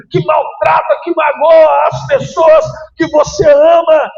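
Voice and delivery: loud, high, shouted sermon climb; full-force preaching. Acted. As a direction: preach it hard to the whole church.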